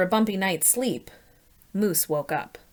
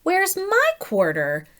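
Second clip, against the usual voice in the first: higher, slow, plaintive; disappointed elephant; 9 semitones higher, 4 dB louder, slower than usual. Acted. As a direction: sound left out. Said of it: "asked Elephant".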